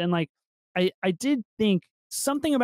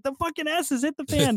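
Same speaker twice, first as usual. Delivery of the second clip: high-pitched imitation of a panicked outburst; mock frantic; 9 semitones higher than usual. Zeke calls Earl at work, mock frantic. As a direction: play-act losing it, voice jumping up high. Frantic phone call quote acted out.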